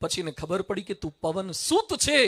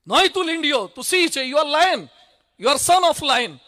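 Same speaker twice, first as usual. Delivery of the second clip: lion's rousing call; 5 semitones higher, 6 dB louder than usual. Acted. Lion roars at the lamb.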